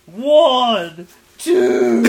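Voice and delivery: very loud, high counting; mock yelled count. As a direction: count aloud like an outraged fan. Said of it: "I yelled that really loud".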